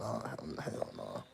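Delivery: slow whispered refusal. Real, not acted. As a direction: breathe it out slowly. Rejecting the idea.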